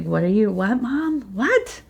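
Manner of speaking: put-on high, puzzled child asking her mom; confused daughter. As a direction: play the baffled little girl, pitch rising up. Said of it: "she's just like".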